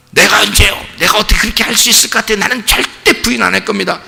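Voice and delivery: high, hurried protest; acted indignant denial. Peter answering Jesus' prediction of three denials, acted indignant denial.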